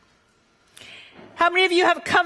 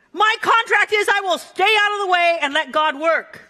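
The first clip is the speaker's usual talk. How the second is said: ordinary delivery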